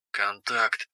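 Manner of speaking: soft whispered word. Acted. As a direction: breathe it out quietly. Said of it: "прошептала Валериус".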